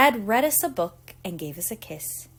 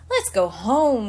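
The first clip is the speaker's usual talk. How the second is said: mild mock cry; 6 dB louder than usual. A narrator's reading. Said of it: "we all cried".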